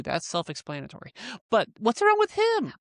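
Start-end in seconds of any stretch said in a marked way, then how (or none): 1.5-2.7 very high-pitched challenge; indignant protest. Real, not acted. Pressing the co-host about a favourite suitor, indignant protest.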